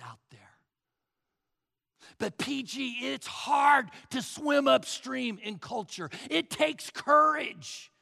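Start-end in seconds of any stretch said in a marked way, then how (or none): 2.2-6.1 raised, higher protesting voice; acted objection. Church member objecting to the pastor, loud protest. 6.3-7.9 loud, high, slow and drawn out; emphatic protest. Acted. Church member protesting to the pastor, loud and emphatic. Listener's objection, then pastor agrees.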